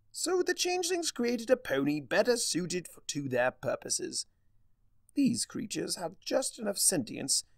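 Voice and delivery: Discord voice. Acted. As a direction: savour each word like a smug trickster. Discord explains the ponies.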